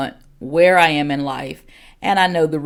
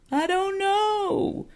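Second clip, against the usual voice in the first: very high, slow mock whine of a child; imitated crying child; 3 dB quieter, much slower than usual. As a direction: play a tearful kid, drawn out and high. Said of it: "had a child and they're crying".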